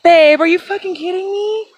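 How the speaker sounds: can't believe it, shouting; excited disbelief. Real, not acted. Disbelief at finding arrowhead.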